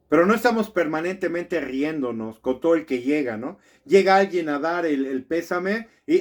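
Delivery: ordinary delivery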